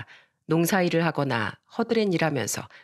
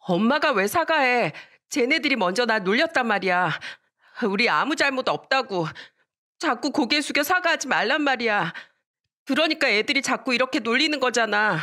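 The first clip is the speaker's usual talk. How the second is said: put-on higher-pitched child's aggrieved protest; indignant yelling; 6 semitones higher than usual. Daughter stops her mother apologizing, high indignant protest. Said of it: "엄마를 말리면서 소리쳤습니다".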